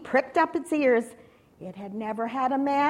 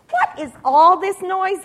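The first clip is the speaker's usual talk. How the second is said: loud, higher demand; exclaimed question; 7 semitones higher, 9 dB louder than usual. A narrator's reading. People of Jerusalem asking about the uproar, exclaiming. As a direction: call out over a noisy crowd.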